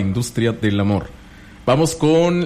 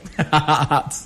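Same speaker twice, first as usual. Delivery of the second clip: short, real chuckle; amused laugh; 4 semitones higher than usual. Real, not acted. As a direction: laugh at what was just heard. Se ríe del voto repetido. (risa divertida)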